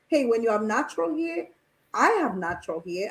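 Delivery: ordinary delivery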